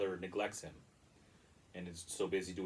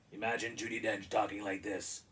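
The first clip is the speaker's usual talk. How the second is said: put-on lightly gruff old woman; a hint of a gruff leprechaun; gruff Judi Dench voice. Shown as a demonstration. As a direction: grumble it like a tough captain.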